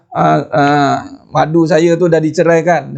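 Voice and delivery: normal talking voice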